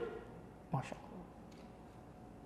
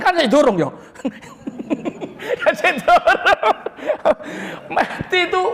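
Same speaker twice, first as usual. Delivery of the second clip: laughing; 5 semitones higher than usual. Real, not acted. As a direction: laugh it out playfully. Laughing joke with listener.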